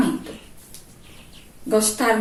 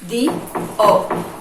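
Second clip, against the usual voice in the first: realmente de O said very slowly, leaning on the O; slow, deliberate teacher stress; slow emphasis; much slower than usual. Shown as a demonstration. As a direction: draw out de O so it lands clearly. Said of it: "na palavra dominó".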